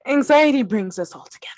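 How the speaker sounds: mock whisper. Joking about shared anxiety.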